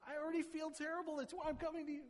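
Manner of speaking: high, whiny voice with a laugh, protesting; the hurt apologizer squeaking in disbelief; pleading wronged friend. Retold or imitated. Apologizer whines back at the scolding friend, high and exasperated. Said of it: "And you're like".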